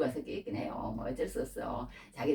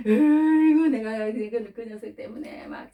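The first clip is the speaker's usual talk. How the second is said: mock exasperated sigh; 7 semitones higher, 7 dB louder, slower than usual. Voicing God's exasperation.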